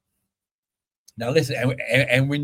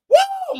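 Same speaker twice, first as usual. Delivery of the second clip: sudden high whoop of joy; ecstatic high woo; 3 dB louder than usual. Real, not acted. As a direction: let out a joyful shriek of praise. Preacher's ecstatic outburst.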